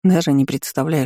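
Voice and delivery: usual speaking voice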